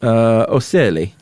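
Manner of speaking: ocelli in a hammed-up Italian accent, ch sound. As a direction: say ocelli Italian style, o-CHEL-li. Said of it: "in your Italian accent".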